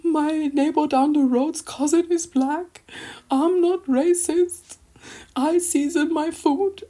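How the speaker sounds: high, slow, whiny put-on voice; mock the excuses in a drawn-out high whine; exaggerated defensive whine, far above own pitch. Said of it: "you don't need to be like".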